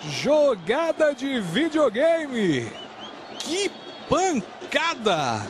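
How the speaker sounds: very slow stretched goal cry; explosive goal commentary. Real, not acted. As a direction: hold each vowel of the goal call. Drawn-out goal celebration call.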